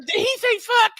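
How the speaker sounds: high, mock-scandalized squeak; imitating a shocked believer in a piping voice; shocked follower. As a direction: squeal it like a prim churchgoer who can't believe it. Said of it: "He said".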